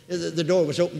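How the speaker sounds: usual speaking voice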